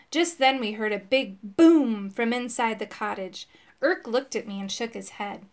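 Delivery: usual speaking voice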